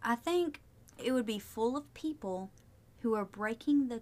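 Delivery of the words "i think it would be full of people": normal talking voice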